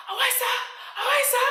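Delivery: high shrill mock scream of a name; imitating a landlady screaming at the door. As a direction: shriek the name like an angry landlady. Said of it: "this woman comes screaming at my door".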